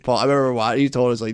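usual speaking voice

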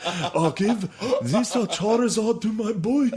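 high, slow mock-desperate plea; hammed-up begging in a high put-on voice; pleading dying parent. Dying prisoner handing a toy to his son, high mock plea.

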